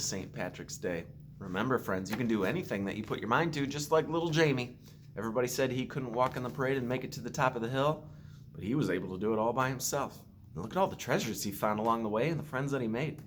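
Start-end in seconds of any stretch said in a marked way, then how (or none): none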